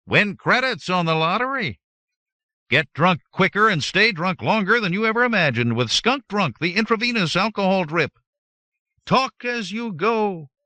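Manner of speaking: high, loud hard-sell ad pitch; pushy advert voice. A narrator's reading. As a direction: blare it like a shop ad. Shouted ad appeals from displays.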